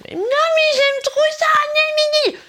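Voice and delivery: high, loud bratty whine; whining child. Retold or imitated. Child refusing to give up toys, high loud whine. Child protesting toy removal.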